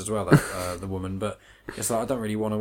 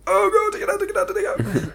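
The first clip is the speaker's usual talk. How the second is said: panicked; 7 dB louder, faster than usual. Retold or imitated. Retelling panicked movie moment.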